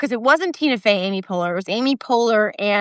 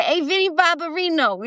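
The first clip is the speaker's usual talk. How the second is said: higher-pitched sitcom Brooklyn wise guy; Vinny Barbarino; 7 semitones higher than usual. Acted. Suggesting a Welcome Back, Kotter bit, Brooklyn character voice. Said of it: "like, John Travolta from, uh, from Welcome Back, Kotter".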